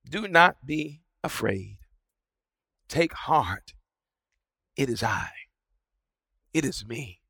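soft, low, unhurried reassurance; a quiet, steady call across the water; calm voice of Jesus. A narrator's reading. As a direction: speak peace into the storm gently. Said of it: "another voice cried out".

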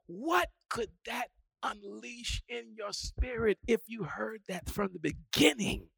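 normal talking voice

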